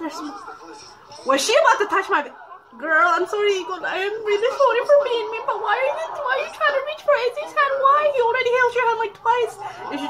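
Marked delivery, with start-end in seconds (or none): 1.3-9.5 high, loud, laughing jealous rant; loud high jealous complaint; jealous outburst. Real, not acted.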